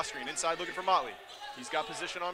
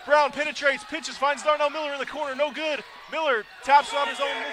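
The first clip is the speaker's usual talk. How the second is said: excited yelling commentary; shouting play-by-play; 10 semitones higher, 10 dB louder than usual. Real, not acted. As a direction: shout the call, high and loud. Buzzer-beater play-by-play climax.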